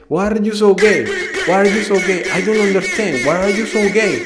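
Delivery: put-on loud, high accented English shout; repeated yell. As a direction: keep hammering the same question. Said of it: "lo único que me decía".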